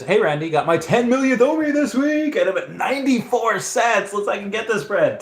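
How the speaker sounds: high-pitched, excited mock celebration; hyped-up reading. Streamer reading a viewer's milestone message, high excited voice. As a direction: read it out high and hyped, like a big brag.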